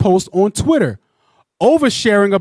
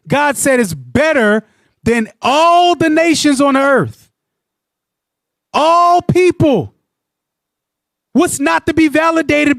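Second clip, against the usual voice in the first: preaching; 7 semitones higher, slower than usual. Real, not acted. Preaching with fervor.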